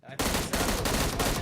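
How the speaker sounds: tight call that bursts loud; tense whisper then triumphant yell. Real, not acted. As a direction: hold tension then let it out. Caster calling a kill.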